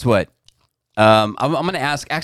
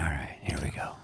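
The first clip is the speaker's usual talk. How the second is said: whisper; 11 semitones lower, 15 dB quieter than usual. Real, not acted. Hushed before the reading.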